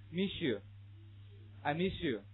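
"Miss you" slowly as mi-shu; drawn-out sh blend in "Miss you" for repetition; read-after-me linking. Shown as a demonstration. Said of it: "发生了这样的音变".

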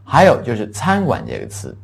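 ordinary talk

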